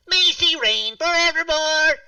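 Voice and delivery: high, loud, slow final cry; grand proclamation. Acted. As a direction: belt the last line like a decree. Climax of poem.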